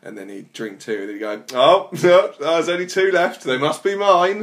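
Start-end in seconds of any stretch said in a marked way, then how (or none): 1.5-4.4 high, loud mock dismay. Performer acts out [name]'s two-beer joke, hammed-up loud dismay. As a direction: cry it out in exaggerated alarm.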